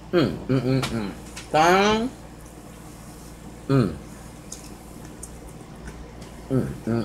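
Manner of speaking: closed-mouth humming while eating; muffled, food-filled murmurs; chewing with mouth full. Real, not acted. Seen 2: Sushi bitten just before; cheeks bulge and jaw works throughout.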